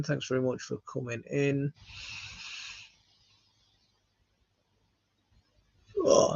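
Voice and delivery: sleepy voice mid-yawn; talking through a yawn. Real, not acted. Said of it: "I'm yawning really bad today".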